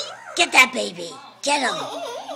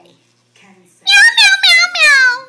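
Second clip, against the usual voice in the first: high loud meowing; cat meow; 14 dB louder, much slower than usual. Retold or imitated. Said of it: "What's the kitty say?".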